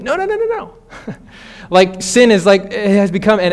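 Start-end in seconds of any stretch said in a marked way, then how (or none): 0.0-0.7 very high, rapid squeaky refusals; frantic denial. Retold or imitated. Sinner refusing ownership, high frantic no-no-no. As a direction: squeal it off like a hot potato. Refusing blame, hot potato.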